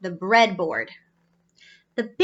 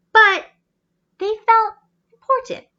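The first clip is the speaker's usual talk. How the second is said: dramatic emphasis; 10 semitones higher, 7 dB louder than usual. A narrator's reading. Dramatic story beat.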